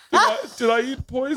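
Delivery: high childish mock panic; little girl voice. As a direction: squeak it out like a scared kid. Daughter voiced asking dad worried.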